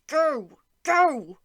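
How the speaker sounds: rising to a loud shout on go; urgency bursting into a shout; urgent cry. A narrator's reading. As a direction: shout the last go out. Urgent instinct urging him on.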